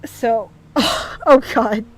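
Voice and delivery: laughing through real tears; overwhelmed, tearful laugh; crying. Real, not acted. Streamer addressing Mooney while crying and laughing. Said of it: "crying like this".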